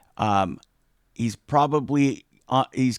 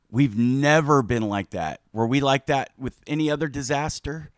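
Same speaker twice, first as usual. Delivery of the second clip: ordinary delivery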